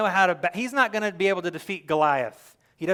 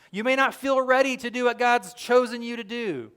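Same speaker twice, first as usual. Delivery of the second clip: usual speaking voice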